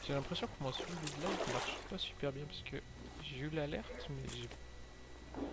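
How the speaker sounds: ordinary talk, nothing marked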